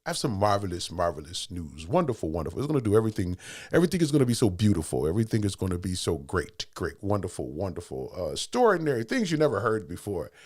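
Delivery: president impression. Quoting the president to listeners, lightly mimicked. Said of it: "how the president said".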